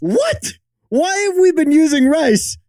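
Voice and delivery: high, sharp shocked yelp; surprised. Real, not acted. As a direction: blurt it out in disbelief. Shocked at rice news.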